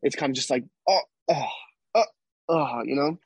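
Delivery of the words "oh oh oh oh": mock startled gasps. Startled reaction, mimicked.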